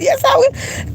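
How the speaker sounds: put-on high, shouted and teary childish comeback; a high kid's yell, half laughing, half crying; defiant kid yell. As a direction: yell it back at the brothers like a dared kid. Kid yelling back at brothers.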